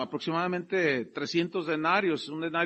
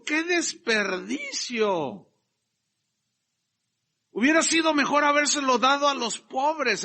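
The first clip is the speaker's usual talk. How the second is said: louder, higher self-righteous complaint; hammed-up pious Judas; mock pious complaint; 7 semitones higher, 6 dB louder than usual. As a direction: play Judas faking concern. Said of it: "Él dijo".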